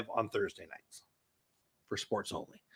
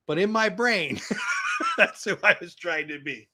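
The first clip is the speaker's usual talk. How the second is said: laughing at himself mid-sentence; 4 semitones higher than usual. Real, not acted. Streamer laughing at his teenage dream. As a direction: breaks into a laugh while admitting it.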